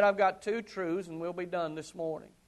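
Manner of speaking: ordinary talk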